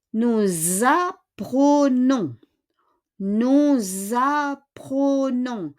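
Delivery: very slow "nous apprenons", S linked to the A as Z; Z liaison. Shown as a demonstration. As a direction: draw out "nous apprenons" as nou-zapprenons. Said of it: "we pronounce it as Z".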